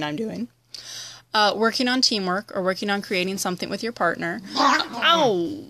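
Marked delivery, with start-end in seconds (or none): 4.6-5.6 sharp yelp of pain; startled cry of pain; sudden ow. Real, not acted. Dog hurts her mid-sentence.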